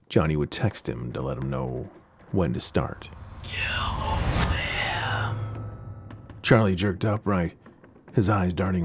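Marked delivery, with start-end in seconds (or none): 3.4-5.4 unvoiced eerie whisper; ghostly whisper. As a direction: breathe it like a ghost.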